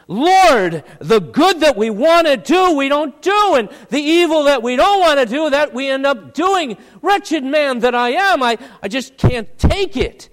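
loud, high-pitched anguished plea; wretched cry. Acted. Preacher acting a struggling sinner crying out to the Lord, wretched. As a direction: cry out to God in wretched frustration, easing slightly towards the end. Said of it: "in a wretched sort of way".

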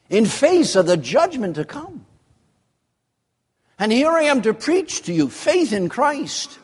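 high-pitched, insistent preacher's appeal; impassioned pleading. Acted. Paul reasoning with Felix about judgment to come, high impassioned pleading. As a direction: plead urgently, voice pushed up high. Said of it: "As if to say".

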